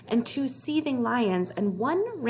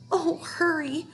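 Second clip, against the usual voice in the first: higher pleading little dragon; 7 semitones higher, slower than usual. A narrator's reading. Dragon pleading while the rope is sawed, higher voice. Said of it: "the dragon kept saying".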